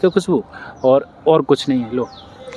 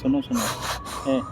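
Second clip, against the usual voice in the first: much softer, gentle hushing to calm her tears; 11 dB quieter than usual. Real, not acted. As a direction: hush her tenderly, over and over. Comforting crying girl. (रोती बेटी को धीमी, कोमल आवाज़ में चुप कराना)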